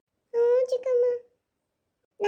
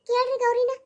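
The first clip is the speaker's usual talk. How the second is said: usual speaking voice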